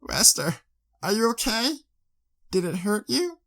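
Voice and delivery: put-on high girlish voice, gently anxious; worried young mare. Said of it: "In a worrying tone".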